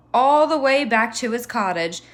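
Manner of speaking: ordinary delivery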